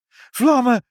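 high, loud shriek of alarm; alarmed cry. A narrator's reading. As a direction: cry out that you've seen danger. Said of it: "riep Ibbeltje". (hoge, luide alarmkreet)

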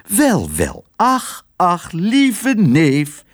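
hoog, langzaam tantestemmetje; oude tante. Acted. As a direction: een overdreven lieve oude tante. Tante begroet neef. (hoge, trage stem van een tante)

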